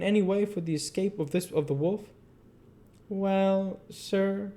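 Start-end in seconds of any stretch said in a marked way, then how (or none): 3.1-4.5 a falsely humble, wary "Well, sir"; suspicious modesty. A narrator's reading. Keeper to the visitor, with suspicious modesty. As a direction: play it modest but guarded. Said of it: "with a suspicious sort of modesty".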